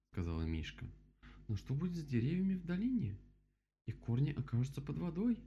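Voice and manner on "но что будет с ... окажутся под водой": high-pitched storybook bear; voiced bear character. A narrator's reading. Bear looks over the dam, high character voice.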